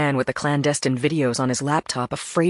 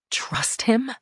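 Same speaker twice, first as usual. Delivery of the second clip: usual speaking voice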